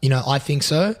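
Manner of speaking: ordinary talk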